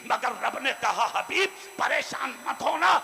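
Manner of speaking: loud, high sermon at full force; fiery preaching. Acted. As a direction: proclaim it over the crowd. Preacher recounting battles.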